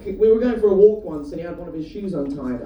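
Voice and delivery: ordinary delivery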